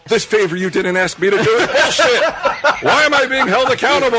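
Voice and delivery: high, loud sarcastic whine; mock outraged rant. Friend mocking the driver's complaint, high loud whine. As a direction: parody his own indignation.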